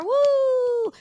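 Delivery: high, loud "woo"; imitated crowd cheer. Crowd cheering the singer's message, imitated whoop. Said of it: "then it was more".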